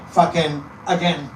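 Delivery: ordinary delivery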